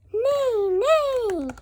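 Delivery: high, loud whinnying nay; horse neigh. Acted. Child reading a story gives the horse a high neigh.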